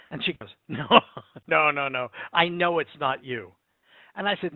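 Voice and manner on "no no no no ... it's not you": insistent denial. Retold or imitated. Wife insisting, retold.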